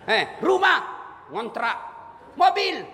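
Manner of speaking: a hint of a high, nosy angel asking questions; questioning angel. Angel grills Inem about her life, lightly voiced and high.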